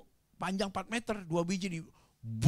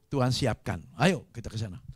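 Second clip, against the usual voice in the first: ordinary delivery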